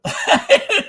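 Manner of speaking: high burst of laughter; loud, high-pitched giggle; real laughter. Real, not acted. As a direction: crack up loudly. Cracks up at own imitation.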